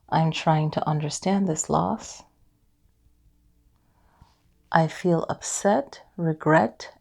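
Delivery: calm EFT tapping affirmations; guided affirmations. Acted. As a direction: guide the listener gently through each line.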